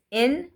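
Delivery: "in" with a plain n ending, no g; dropped g. Shown as a demonstration. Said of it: "the I N G either come, becomes".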